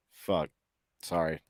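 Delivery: normal talking voice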